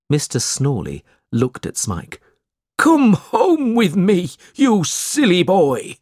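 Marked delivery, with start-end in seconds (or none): 2.8-6.0 high, slow, loud put-on scolding; scolding father. A narrator's reading. Snawley ordering Smike home, scolding loudly. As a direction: order the boy home, drawing it out.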